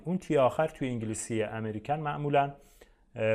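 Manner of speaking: usual speaking voice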